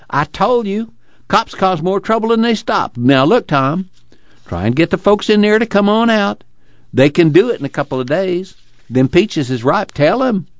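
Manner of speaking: high-pitched, urgent pleading; excited cry. A narrator's reading. Casey urges Tom to get the folks out, excited. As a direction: press the point eagerly, voice up high. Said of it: "he cried".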